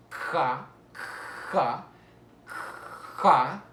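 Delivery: ja with a long rasped J from the back of the mouth; loud, isolated ja, heavy throat friction on the J; velar fricative. Shown as a demonstration. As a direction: punch out ja with a scraped velar onset. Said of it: "Vamos a ver las velares".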